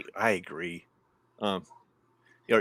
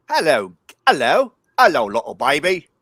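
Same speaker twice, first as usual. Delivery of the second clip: put-on Cockney accent; 7 dB louder than usual. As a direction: coo at a baby in Cockney.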